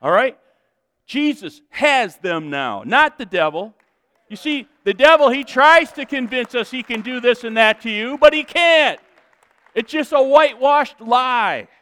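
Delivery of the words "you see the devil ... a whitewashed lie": loud, high, building denunciation; impassioned preaching. Real, not acted. Preacher exposing the devil's lie, impassioned. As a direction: denounce it at full force.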